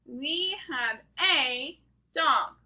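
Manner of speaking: "We have a dog" slow, with "a" as a full "ay"; measured early-reader pace, every word distinct; slow sentence reading. Shown as a demonstration. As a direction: read "We have a dog" slowly, leaning on "a". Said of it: "let's read these sentences".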